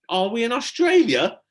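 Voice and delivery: high, loud hopeful shout. A narrator's reading. Tom to Stella, climbing down into light, hopeful shout. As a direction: shout it hoping it's true. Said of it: "shouted Tom hopefully".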